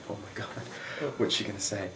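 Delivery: hushed inner panic; freaking out. Retold or imitated. Boy waits for cheerleader's answer, quietly freaking out. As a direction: fret under your breath, acting it out. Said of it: "start freaking out".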